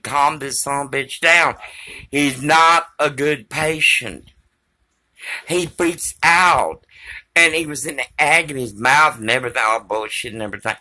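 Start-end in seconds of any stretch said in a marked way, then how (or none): none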